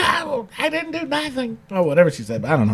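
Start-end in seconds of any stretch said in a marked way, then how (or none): 0.0-1.6 high, soft mock protest; bad Stephanie impression. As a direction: badly imitate a whining denial in a thin, raised voice. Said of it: "it's a bad impression".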